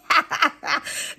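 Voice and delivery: short burst of real laughter. Real, not acted. Who bewitched me translated for viewers, laughing. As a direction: crack up for a moment.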